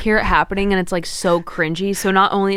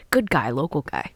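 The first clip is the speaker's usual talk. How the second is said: put-on Boston accent; 4 semitones lower, 4 dB quieter than usual. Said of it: "there's one that just says".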